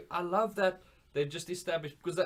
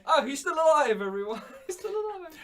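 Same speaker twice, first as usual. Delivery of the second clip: excited announcement; 13 semitones higher, 6 dB louder than usual. Retold or imitated. Cheering Meek survived, mock announcement.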